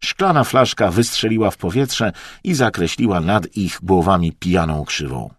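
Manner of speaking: ordinary delivery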